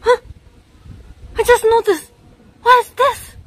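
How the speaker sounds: high, loud squeak of shock; startled. Real, not acted. Streamer suddenly noticing something odd, startled squeal. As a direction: blurt it out high and alarmed.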